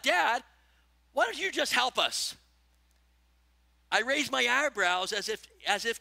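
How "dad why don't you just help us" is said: put-on louder and higher child's cry; fed up, calling up the stairs; a child yelling up at dad, exasperated. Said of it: "one of the kids yelled out".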